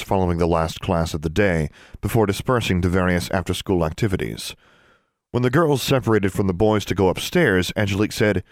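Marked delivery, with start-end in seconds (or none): none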